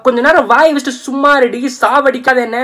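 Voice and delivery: fed-up yelling. Acted. Snapping at the girl who won't stop talking, fed-up yell. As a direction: blow up at her to shut her up.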